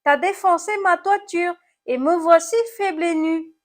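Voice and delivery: high, loud snail lament; moaning complaint. A narrator's reading. Snail blames apple for its smashed roof, moaning. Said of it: "gémit l'animal cornu".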